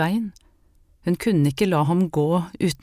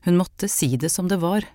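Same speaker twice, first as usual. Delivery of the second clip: ordinary delivery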